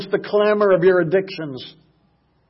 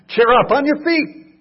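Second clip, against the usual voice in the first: high, raised encouraging call; 10 semitones higher, 5 dB louder than usual. Retold or imitated. Crowd calling the blind man to Jesus, raised voice. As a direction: rouse him to his feet.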